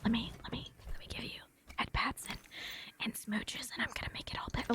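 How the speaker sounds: mock-tender whisper; hushed, hammed-up coddling; whispered lover. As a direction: whisper like a clingy lover soothing. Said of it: "like, a lover and be like".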